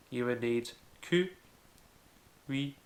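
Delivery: ordinary talk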